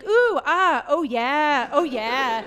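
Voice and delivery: loud hammed-up sex moans; mock moaning. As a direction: ham up breathy moans. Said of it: "it's like".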